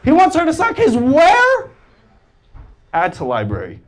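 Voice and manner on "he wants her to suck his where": higher, louder appalled disbelief; hammed-up horror; shocked. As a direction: recoil at the lyric. Mock shocked at lyric.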